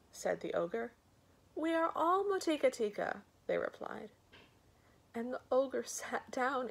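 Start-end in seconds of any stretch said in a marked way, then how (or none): 1.6-3.2 babies' voices. Acted. Babies reply together.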